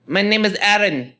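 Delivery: mimicked Chinese customer. Customer tells cashier her English name, mimicked Chinese customer. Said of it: "that Chinese bitch".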